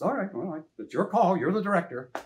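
lightly voiced huffy actor giving in; Adam West impression. Actor conceding to his director, lightly voiced Adam West. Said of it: "You do a great Adam West impression".

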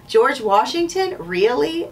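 high, bright disbelieving question; surprised girl. Acted. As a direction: Annie can't believe it's him. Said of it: "said Annie".

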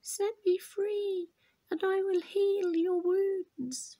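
a small trapped creature calling gently; Hope pleading from the box corner, high, soft and slow; tiny creature voice. Acted. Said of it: "a tiny creature was calling".

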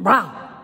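a sudden loud mock bark; imitating a dog bark. Preacher imitating a stray dog lunging with a loud bark. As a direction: bark it out to startle the room. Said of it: "that dog may just go".

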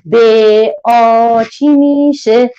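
slow, drawn-out classroom question; slow teacher prompt. Shown as a demonstration. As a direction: stretch each sound for the children. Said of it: "بخونید با هم".

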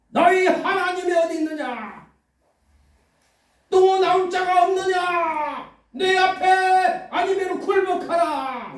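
arrogant giant. Acted. Goliath taunting Israel's army, slow arrogant roar. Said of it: "위세 당당하게 말하잖아요".

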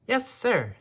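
high, quick little mouse reply; eager mouse. A narrator's reading. Mouse obeys the cat's order, high and eager. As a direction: squeak out an eager yes. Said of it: "said the mouse".

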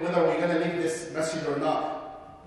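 ordinary talk, nothing marked

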